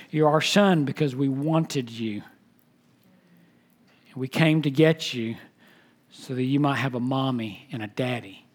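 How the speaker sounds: hushed, tender words from an adoptive father. Retold or imitated. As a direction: gently tell your child he was wanted. Father tells son why adopted.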